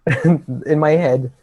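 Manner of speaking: ordinary talk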